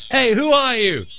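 high call, no real volume; mock shouting over. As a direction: call across the dune to a stranger. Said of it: "the leader shouts over".

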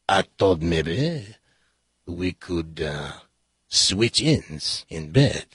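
slow, halting, low sheepish husband. Acted. Husband hesitantly proposing to his wife, slow and halting. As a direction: ease the idea out slowly and nervously.

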